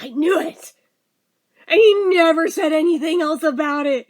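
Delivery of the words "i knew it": retold whisper. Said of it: "He's like".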